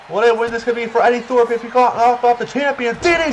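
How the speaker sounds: excited ringside play-by-play; wrestling commentator. Acted. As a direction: hype every move like live TV.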